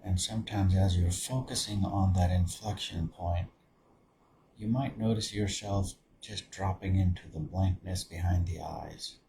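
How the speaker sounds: soft, low-key meditation voice with long pauses; guided meditation. Acted. Meditation leader settling listeners into open awareness, guided meditation. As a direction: guide them gently inward, softly, leaving space between phrases.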